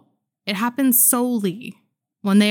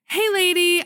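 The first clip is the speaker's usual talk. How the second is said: a light, high impression of the maintenance guy; high mock voice of the maintenance man; 11 semitones higher, 3 dB louder than usual. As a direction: squeak out a friendly hey lady, pitched up. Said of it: "I'm not gonna do his Mexican accent".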